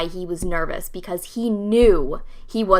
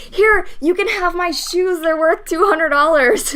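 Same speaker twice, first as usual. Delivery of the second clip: high, loud mock desperate offer; hammed-up panicky bargaining; nervous guy; 8 semitones higher, 4 dB louder than usual. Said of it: "He goes, he goes".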